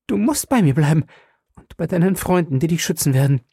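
high, fast, desperate pleading; cling to him and beg him to stay; frantic cry. A narrator's reading. Wife begging her husband not to leave, frantic. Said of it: "klammerte sich an ihn und schrie".

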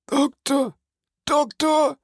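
high, panicked cry for help; desperate call. A narrator's reading. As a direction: call out in terror. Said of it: "rief Barrois".

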